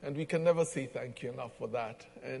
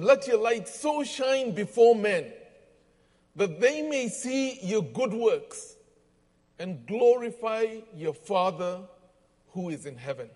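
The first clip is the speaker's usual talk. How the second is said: scripture reading; 6 semitones higher, 3 dB louder than usual. Acted. Reads scripture aloud.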